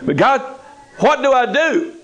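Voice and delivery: high, pleading question; rising, helpless plea; desperate believer. Acted. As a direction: cry out to God for help.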